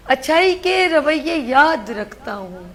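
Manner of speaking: slow, heartfelt verse recital; poetry recitation. Acted. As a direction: recite a farewell couplet with feeling. Anchor's farewell couplet. (جذباتی انداز میں شعر پڑھنا)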